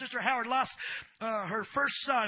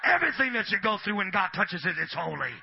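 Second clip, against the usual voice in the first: loud shouted sermon; preaching at full force; 3 semitones higher, 6 dB louder than usual. Acted. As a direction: preach it hard to the crowd.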